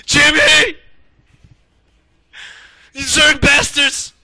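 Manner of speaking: hammed-up war movie cry; anguished shout. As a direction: scream for a fallen buddy. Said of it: "his buddies are like".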